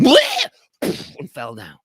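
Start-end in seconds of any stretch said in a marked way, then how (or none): 0.0-0.5 high, loud choking squawk; a bird keeling over with a squawk; high strangled bleh; parrot collapse squawk. Acted. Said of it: "another parrot went".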